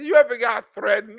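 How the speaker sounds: ordinary talk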